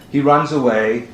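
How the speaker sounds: ordinary talk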